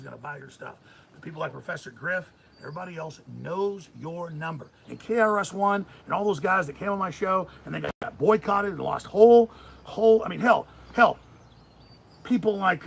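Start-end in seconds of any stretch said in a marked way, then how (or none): 4.9-12.9 loud heated ranting; angry rant. Real, not acted. Host railing against critics, loud and angry. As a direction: shout it out in rising anger. Ranting about boycotted guests.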